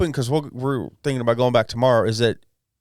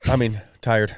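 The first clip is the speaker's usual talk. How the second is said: normal talking voice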